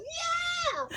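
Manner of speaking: high shouted yeah; excited yell. Retold or imitated. She answers the kids with a high shout. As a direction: crow with glee.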